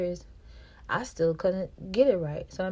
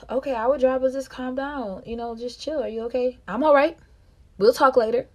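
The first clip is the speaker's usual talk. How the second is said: quoting him; 6 semitones higher, 7 dB louder than usual. Retold or imitated. Retold tense car exchange.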